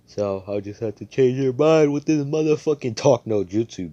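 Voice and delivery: loud, high hammed-up hero speech; bombastic declaration. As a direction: build it into a big mock battle cry. Hero's big declaration.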